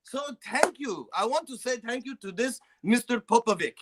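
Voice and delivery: high, hammed-up Serbian accent; comic Serbian accent thanking a friend on a podcast. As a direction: play the grateful Serbian guy in a thick put-on accent. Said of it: "I'm the, like, the Serbian guy".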